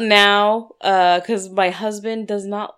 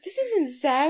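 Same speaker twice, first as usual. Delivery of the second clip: teasing, laughing; 8 semitones higher, 4 dB quieter, slower than usual. Retold or imitated. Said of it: "I'm like".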